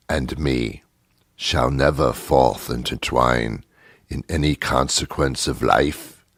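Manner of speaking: slow, portentous British Shakespearean soliloquy; hammy British thespian with long pauses; mock British accent. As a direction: intone nonsense verse gravely in a British stage voice. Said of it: "They have this British accent".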